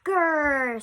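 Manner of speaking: loud playful growl; rhino growl. Acted. Rhinoceros growling at a small porcupine, loud mock growl. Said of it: "said the rhinoceros".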